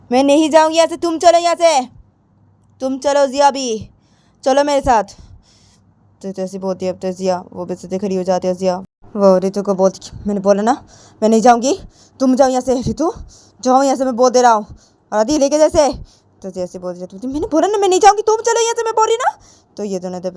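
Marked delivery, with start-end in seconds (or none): 0.1-5.1 higher, louder refusal; loud insisting. Acted. Ritu refuses, argues. 10.3-15.9 louder, rising angry order; angry insisting. Acted. Jiya shouts at Ritu. 17.3-19.3 much higher, loud refusal; high loud insistence. Acted. Jiya insists again.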